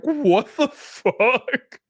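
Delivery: very high, drawn-out incredulous cry; retold what-on-earth reaction, high and drawn out; stunned disbelief. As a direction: stretch it out slowly in high-pitched disbelief. Retold disbelief at being refused.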